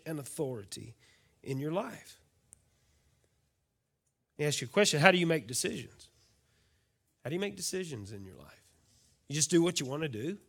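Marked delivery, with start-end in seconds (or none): none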